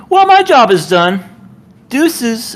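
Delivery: smug Lancer exit line. Retold or imitated. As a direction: toss it off like a hero walking away. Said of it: "Lancer just kinda just goes".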